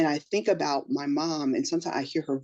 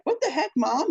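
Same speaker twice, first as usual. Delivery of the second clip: higher, put-out protest to her mother; indignant daughter; 10 semitones higher than usual. Retold or imitated. Kathy Bates answering her unimpressed mom, indignant. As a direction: play the daughter stung by mom's shrug. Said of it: "she was like".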